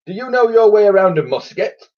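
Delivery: put-on high loud old admiral; booming admiral. A narrator's reading. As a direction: boom the question. Admiral asks loudly.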